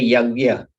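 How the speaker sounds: "yagya" as loud "YAG-ya", no n; North Indian pronunciation. Shown as a demonstration. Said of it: "But in North, they say".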